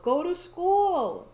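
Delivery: loud, high, drawn-out order; exasperated scolding. Real, not acted. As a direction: scold them loudly, stretching it out. Scolding a game character.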